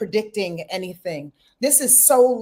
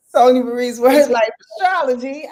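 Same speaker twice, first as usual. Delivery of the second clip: louder, rising announcer-style show title; proudly announced show title; show announcer plug; 3 semitones higher, 6 dB louder than usual. Shown as a demonstration. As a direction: announce the show name like a host on cue. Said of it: "Go ahead, Sonia Marie".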